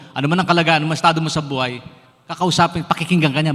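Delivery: loud, rising preacher's declaration; full-force preaching. Acted. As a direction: preach it hard to the congregation. (malakas na pangangaral)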